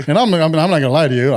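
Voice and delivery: usual speaking voice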